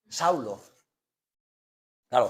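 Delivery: usual speaking voice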